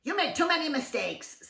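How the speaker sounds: a touch of a nagging wife; scolding. Acted. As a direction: tut at him. Missus Bird scolding.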